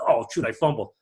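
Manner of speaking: ordinary talk, nothing marked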